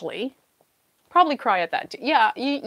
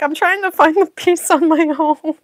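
playful protest; 7 semitones higher, 8 dB louder than usual. Retold or imitated. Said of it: "I'm like".

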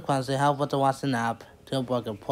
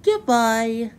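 higher, louder called goodbye; 9 semitones higher, 5 dB louder than usual. A narrator's reading. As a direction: call a farewell across the garden. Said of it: "called Mister Fuzzy".

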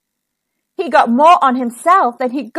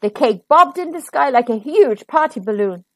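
usual speaking voice

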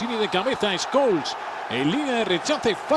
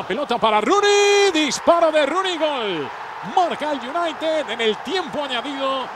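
excited goal call; 5 semitones higher, 3 dB louder than usual. Real, not acted. Spanish late goal call.

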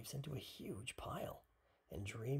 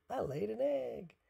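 loud, high duck announcement; high-pitched exclaimed boast; crowing cry; 8 semitones higher, 7 dB louder than usual. A narrator's reading. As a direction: crow it out proudly, high and loud. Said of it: "cried Duck".

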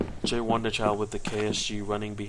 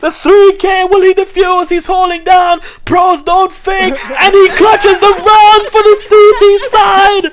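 hyped play-by-play, much higher and louder; esports caster; 16 dB louder than usual. Acted. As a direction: yell the clutch call, building as it goes. Clutch round play-by-play.

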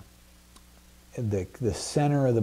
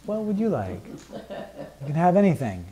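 higher-pitched, gracious royal offer; gracious king; 4 semitones higher than usual. Acted. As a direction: play the king indulgently offering a favor. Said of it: "He doesn't say it like that".

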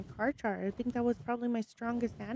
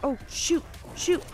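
much higher, louder panic; real panic rising in pitch; frantic alarm; 10 semitones higher, 5 dB louder than usual. Real, not acted. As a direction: shriek out as it all goes wrong. Creature being killed.

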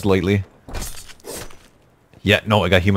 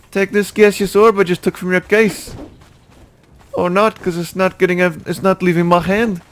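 higher, teasing mock voice; higher mocking pirate voice; mocking pirate taunt; 11 semitones higher than usual.

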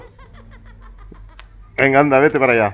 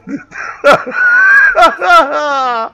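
high loud screaming burst of laughter; shrieking laugh; 3 dB louder than usual. Real, not acted. Owner shrieks with laughter at the cat.

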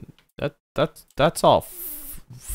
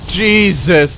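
loud, higher burst of surprise; startled exclamation; 5 semitones higher, 8 dB louder than usual. Real, not acted. Player seeing the car fly away, startled exclamation. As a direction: blurt it out loudly, startled.

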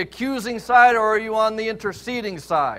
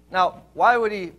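ordinary talk, nothing marked

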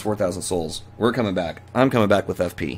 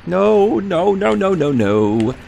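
panicked; 9 semitones higher, 4 dB louder, slower than usual. Real, not acted. Panic as enemy attacks.

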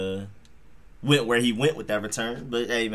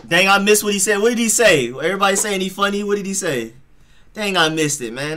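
frustrated excitement; 8 semitones higher, 4 dB louder than usual. Real, not acted. Missed the commentator's joke.

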